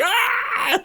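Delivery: high mock scream of panicked people. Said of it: "running around going like".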